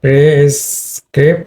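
prescription slowly split into syllables; correct prescription. Shown as a demonstration. Teacher applying same rule, prescription broken into slow syllables. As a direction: sound out pri, scrip, shan. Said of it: "এখানে হবে".

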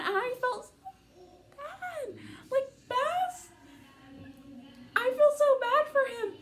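high, teary voice breaking over a character; choked up with real sympathy; tearful fan gushing about Baz; real crying. Real, not acted. Upset about a book character.

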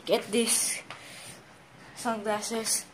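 usual speaking voice

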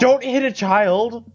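high slow pleading shout; alarmed shout. Acted. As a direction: cry out to stop him. Stopping a fight.

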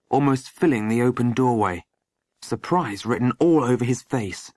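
usual speaking voice

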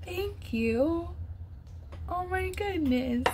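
small high voice, overcome with gratitude; touched, about to cry. Real, not acted. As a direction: squeak out thanks as if tears are coming. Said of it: "I'm about to cry".

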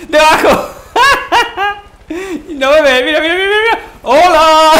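high, loud giddy yelling; excited laughing shout. Real, not acted. Hanging from helicopter, laughing.

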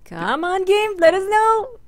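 high-pitched, loud whiny plea; playful pleading. Pretend. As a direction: beg the game, voice climbing high. Pleading with the slow game.

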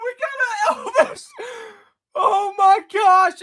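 ecstatic shouting. Real, not acted. Pulling a card he never got before, ecstatic shouting. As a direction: scream it in wild disbelief. Pulls a rare relic.

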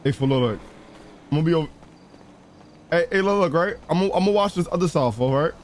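ordinary talk

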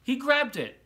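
high, loud burst of triumph; triumphant grab. A narrator's reading. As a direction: shout the climax. Climax of the story.

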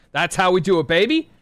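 high, loud victory cheer; triumphant shout. Real, not acted. Gamer celebrating a big play to teammate. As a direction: crow it like you just won.

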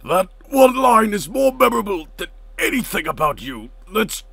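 high, loud put-on retort; indignant character voice. Sparring back in character, indignant put-on voice. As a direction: argue it like an outraged cartoon fighter.

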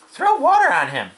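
high, loud command; alarmed order. Acted. Farm wife ordering water for pig, high urgent voice. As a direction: call for help urgently.

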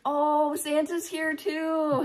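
put-on slow, high little-kid voice. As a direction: imitate a child's excited discovery. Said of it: "And Cash is like".

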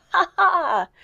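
high stage laugh of a dying villain; wild villain laugh. Acted. Hugo dying on stage, wild melodramatic laugh. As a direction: cackle wildly as the villain. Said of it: "with a wild".

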